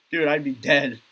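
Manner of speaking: ordinary talk, nothing marked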